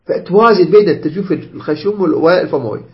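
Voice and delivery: ordinary talk, nothing marked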